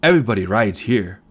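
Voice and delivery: usual speaking voice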